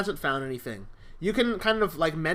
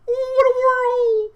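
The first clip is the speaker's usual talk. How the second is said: slow, much higher, louder dramatic wail; dying wail; 8 dB louder, slower than usual. Acted. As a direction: cry it out as the head sails away. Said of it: "You hear it go".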